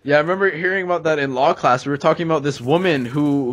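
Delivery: ordinary talk, nothing marked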